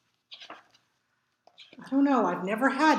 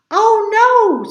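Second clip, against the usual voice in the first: much higher and louder cry; alarmed scream; 11 semitones higher, 11 dB louder than usual. A narrator's reading. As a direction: panic as the kite falls. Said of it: "screamed Mole and Bear".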